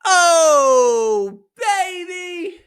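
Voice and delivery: long, high-pitched holler to open the show; hyped shout. Pretend. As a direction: belt it out high and drawn out. Hyped opener after intro.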